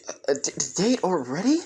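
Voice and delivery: stutter, then blurt it in surprise; surprised stammer. Acted. Karin hears Izuku mention a date, surprised stammering. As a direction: stammer rising to a high question.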